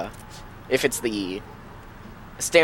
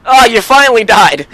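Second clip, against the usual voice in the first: gleeful yell, much higher and louder; excited shout; 10 semitones higher, 11 dB louder than usual. Real, not acted. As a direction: crow with delight at the death. Teammate finally dies in game.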